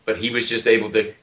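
normal talking voice

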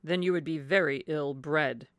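slow, blunt husband's put-down; sour, deliberate rebuke; cried rebuke. A narrator's reading. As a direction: state it loudly and heavily. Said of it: "cried Mister Palmer".

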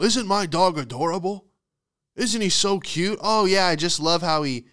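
high, mock-gushing coo; delusional dog owner. Big dog owner showing off the dog, high mock adoration. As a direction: gush over the pet, hammed up. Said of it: "Big dog owners are delusional".

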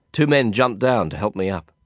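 plain reading voice

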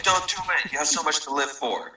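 high, pleading stranger; concerned traveler. Retold or imitated. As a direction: urge him not to jump. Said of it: "So he stops and is like".

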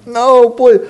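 high mock pleading; put-on high, tearful begging; imitated crying plea. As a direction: act out a shamed victim begging. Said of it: "and they'd start crying".

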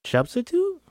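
SUBSTITUTE shot back high, rising at the end; surprised question. Acted. Character echoing the word SUBSTITUTE back, high and surprised. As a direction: echo SUBSTITUTE as a startled question.

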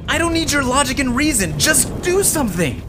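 high, panicked yell; desperate and scared. Acted. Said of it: "I panicked".

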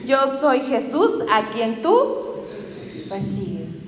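slow solemn Jesus voice. Retold or imitated. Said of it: "qué le dice".

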